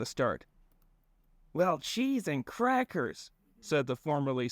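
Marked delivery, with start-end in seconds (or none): 1.5-3.3 slow, high drowsy voice. Acted. Sleepy man's first words.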